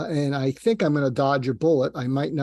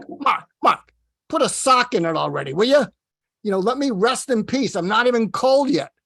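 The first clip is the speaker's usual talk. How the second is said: imitated Brooklyn accent, higher and louder, fed up; Larry King Brooklyn accent; 7 semitones higher, 4 dB louder than usual. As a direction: tell him to pipe down in a mock Brooklyn accent. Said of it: "in his Brooklyn accent".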